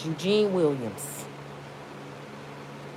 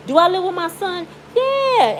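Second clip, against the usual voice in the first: high mock disbelief; incredulous; 9 semitones higher, 3 dB louder than usual. Real, not acted. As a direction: act amazed at the question. Mocking the girl's claim.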